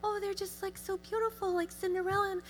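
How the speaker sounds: high, airy gushing over a dainty tear; swooning sigh over beautiful tears; mock pretty crier. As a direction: gush like a fairy-tale princess crying. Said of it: "and they're like".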